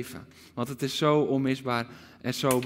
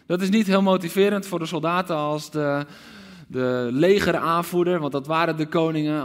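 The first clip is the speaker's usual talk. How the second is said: ordinary delivery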